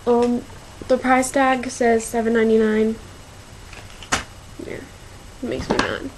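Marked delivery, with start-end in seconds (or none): 4.6-6.1 annoyed. Real, not acted.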